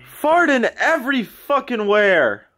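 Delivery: high, slow, drawn-out griping; high-pitched exasperation; exasperated complaint. Real, not acted. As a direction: stretch the words in disgusted protest. Complaining about friend farting.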